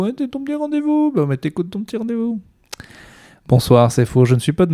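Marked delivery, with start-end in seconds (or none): 0.0-2.4 mock-teasing, pitch sliding way up and held there; playful rising teasing. Host teasing the listener about their little podcast appointment, climbing squeaky voice.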